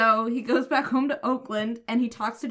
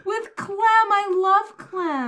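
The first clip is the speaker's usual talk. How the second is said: drawn-out delighted cry, higher and louder; 10 semitones higher, 7 dB louder, much slower than usual. Real, not acted. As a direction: gush over a favourite character. Delighted about Clem.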